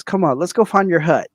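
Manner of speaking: mock coaxing. Friend teasing half-Thai buddy, mock coaxing.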